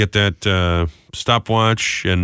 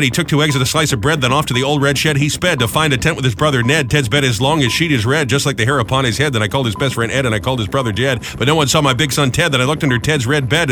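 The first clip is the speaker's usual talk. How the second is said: rapid-fire rhyme in a running singsong; fast reading; 2 semitones higher than usual. Real, not acted. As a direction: race through it against the stopwatch. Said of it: "You did it faster and better".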